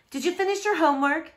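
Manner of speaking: high, quick motherly quizzing; mom's question. A narrator's reading. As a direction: rattle it off like a busy mom. Mom voiced in read dialogue.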